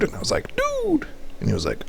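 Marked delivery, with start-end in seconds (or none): 0.6-1.1 squeaky dude. Retold or imitated. His own reply, acted in chat.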